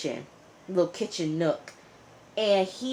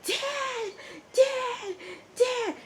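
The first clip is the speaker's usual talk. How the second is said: put-on very high, desperate child's cry; boy yelling; much slower than usual. Zip-tied boy screaming after his dad, very high yell. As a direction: call out for his dad in panic. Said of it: "The boy is yelling".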